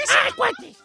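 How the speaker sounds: put-on high childlike Chabelo voice; squeaky child voice. Shown as a demonstration. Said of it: "puedo imitar a Chabelo".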